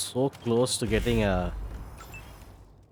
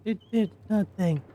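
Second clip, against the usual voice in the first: high squeaky voice; 11 semitones higher than usual. Acted. High voice continues.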